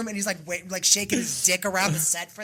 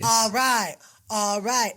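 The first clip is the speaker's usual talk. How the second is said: imitating the singer's fake English "all right"; mock Italian-pop "all right", a bit louder; 3 semitones higher, 3 dB louder, much slower than usual. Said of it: "They're not saying "all right".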